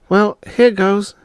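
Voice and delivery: put-on high, slow little girl bracing herself; a young girl steeling herself; girl's voice. Sister bracing before tasting the serum, high girl voice. Bonnie's lines in story.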